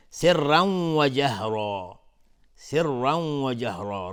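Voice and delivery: loud, slow سرا hummed into the w of و; merging with ghunnah. Shown as a demonstration. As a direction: hold a nasal w between سرا and جهرا. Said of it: "تنوین بعدش واو ادغام با غنه".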